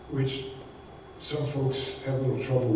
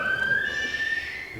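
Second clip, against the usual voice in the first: a high burst of laughter. Real, not acted. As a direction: laugh it off after the punchline. Laughs at own joke.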